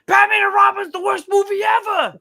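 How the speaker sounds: high, loud hot-take shout; Mad Dog impression. Reviewing movies in Mad Dog mode, high-pitched shouting. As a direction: blast the movie like a radio host. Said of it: "I'll be like".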